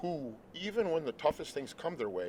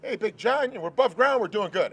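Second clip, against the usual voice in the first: much higher and louder, beaming; cheerful greeting; 9 semitones higher, 10 dB louder than usual. Retold or imitated. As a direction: call out a big happy hello. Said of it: "I'll be like".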